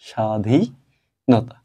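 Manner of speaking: স্বাধীনতা said very slowly, syllable by syllable; slow pronunciation demo. Shown as a demonstration. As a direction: stretch out স্বাধীনতা to show how it sounds. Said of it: "এটার উচ্চারণ হচ্ছে". (স্বাধীনতা খুব ধীরে, প্রতিটি ধ্বনি আলাদা করে)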